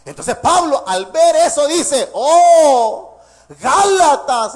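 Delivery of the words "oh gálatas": loud, slow ringing cry of Oh Galatas; Paul's rebuke. Acted. Preacher voicing Paul crying out to the Galatians in a loud rebuke. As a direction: call them out like an apostle rebuking.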